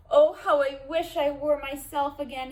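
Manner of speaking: trapped donkey pleading to be himself again; longing wish. Acted. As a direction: ache for it out loud. Sylvester longs to be himself.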